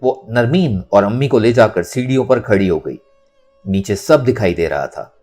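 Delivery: ordinary talk, nothing marked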